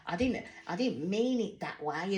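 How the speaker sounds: lightly voiced woe-is-me whine; mock self-pity. Katie feeling sorry for herself, mocked lightly. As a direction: play the sulky martyr. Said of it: "she's like".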